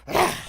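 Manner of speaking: loud sudden revival gasp. Retold or imitated. Friend wakes from dead, a loud gasping ah. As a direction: burst back to life with a loud gasp. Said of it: "this motherfucker just goes".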